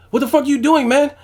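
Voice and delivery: very high, loud mock yell; pissed-off cornerman. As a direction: imitate an exasperated coach screaming at his boxer. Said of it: "he got pissed".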